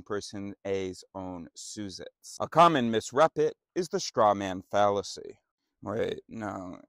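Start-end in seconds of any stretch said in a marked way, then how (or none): none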